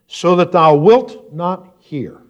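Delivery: "wilt" drawn out, high and loud; slow scripture line with a heavy punch on "wilt"; stress on wilt. Shown as a demonstration. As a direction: lean hard and slowly on "wilt". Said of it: "emphasis on the word will".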